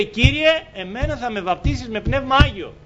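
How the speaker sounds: a high, pleading demand to God; man locked in a room insisting on the Holy Spirit; desperate prayer. Retold or imitated. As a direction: beg the Lord and refuse to give in. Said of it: "και είπε".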